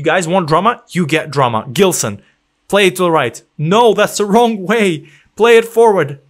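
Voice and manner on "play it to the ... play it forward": louder, urgent commands; anxious coaching shout; shouting at players. Real, not acted. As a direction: bark at the screen.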